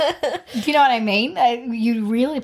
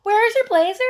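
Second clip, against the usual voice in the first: high put-on Irish accent; lilting high Irish voice; Irish TV quote; 10 semitones higher, faster than usual. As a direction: quote an Irish sitcom character. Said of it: "I just love Dairy Girls".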